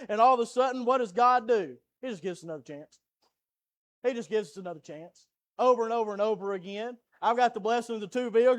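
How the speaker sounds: loud, driving sermon cadence; full-force preaching. Acted. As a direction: press the point home to the church.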